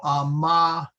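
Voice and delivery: amabimus broken as a-MAA, pause, bimus; accent on second syllable. Shown as a demonstration. Teacher explaining the rule, amabimus accented on MA. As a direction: amabimus with MA held long and the rest after a gap. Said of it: "we put the accent on the".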